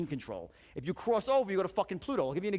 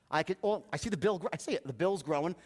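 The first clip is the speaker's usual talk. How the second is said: ordinary talk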